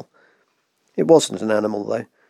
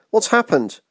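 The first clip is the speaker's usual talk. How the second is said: alarmed; 9 semitones higher than usual. A narrator's reading. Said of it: "she cried".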